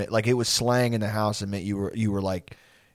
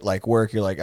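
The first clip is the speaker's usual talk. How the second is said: ordinary talk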